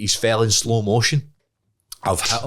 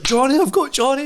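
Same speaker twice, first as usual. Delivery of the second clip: much higher, breathless glee; excited boast; 4 dB louder than usual. Retold or imitated. Running into dad's house, much higher and gleeful. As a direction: burst in bragging.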